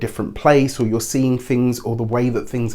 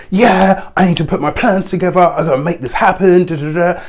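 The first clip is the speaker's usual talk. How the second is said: mock go-getter urgency, higher and louder; fast, aggressive; 7 semitones higher, 6 dB louder than usual. Reader mimicking someone rushing to make plans, fast and aggressive. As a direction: fire it off pushy and hurried, pitched up. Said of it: "not like that fast sort of aggressive".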